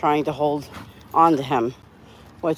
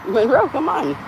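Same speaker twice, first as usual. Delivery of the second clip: high-pitched coaxing call; higher voice, beckoning; calling the dog; 8 semitones higher than usual. Real, not acted.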